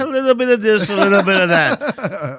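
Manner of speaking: high, loud Yiddish-inflected shrug. Retold or imitated.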